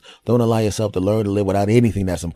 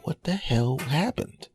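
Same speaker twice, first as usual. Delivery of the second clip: high, slow, drawn-out bafflement; baffled "what the hell"; 9 semitones higher, slower than usual. Retold or imitated. Meeting an ex again, drawn-out baffled surprise. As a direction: stretch it out, confused by the ex.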